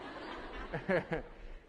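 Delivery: quiet, suppressed laugh; soft giggle. Real, not acted. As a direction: giggle softly to yourself. Amused by audience's answers.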